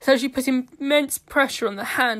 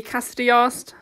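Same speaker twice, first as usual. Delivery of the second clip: ordinary delivery, nothing marked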